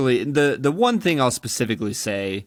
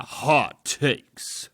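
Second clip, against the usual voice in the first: announcer voice; 2 semitones higher than usual. Shown as a demonstration. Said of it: "better at your announcer voice".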